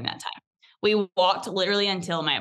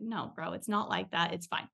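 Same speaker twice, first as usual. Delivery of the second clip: calm reassurance; 8 dB quieter than usual. Retold or imitated. Retelling Colton reassuring the barista.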